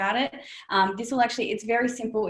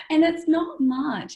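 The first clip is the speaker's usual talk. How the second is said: slow, higher, said through laughter; laughing; 9 semitones higher, 5 dB louder, slower than usual. Real, not acted. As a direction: still laughing at the joke. Laughing after the commit joke.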